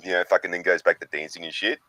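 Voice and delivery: normal talking voice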